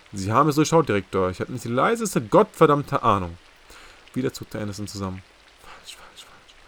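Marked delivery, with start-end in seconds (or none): 0.1-3.4 louder, sharp and aggressive retort. A narrator's reading. Langdon snaps back at the CIA director, aggressive and louder. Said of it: "schlug auch er einen aggressiven Tonfall an". 5.6-6.6 breathy whispered falsch falsch falsch; whispered warning. A narrator's reading. Chief whispers to himself at Langdon's mistake.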